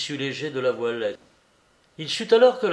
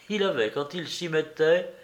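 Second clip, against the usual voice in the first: plain reading voice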